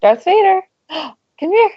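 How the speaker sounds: high-pitched call to a pet; calling a cat. Real, not acted. Podcaster calls the cat Darth Vader over, high coaxing call.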